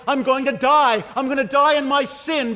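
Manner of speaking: high, loud cry of doom; desperate outcry. Acted. Preacher voicing a sinner hanging over hell, desperate shout. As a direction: cry out as if about to perish.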